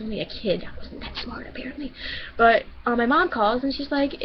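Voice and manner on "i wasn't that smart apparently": softer self-mocking whisper under the breath; whispered aside. Real, not acted. Narrator whispering a self-deprecating aside to viewers. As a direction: let it slip out as a hushed joke on yourself.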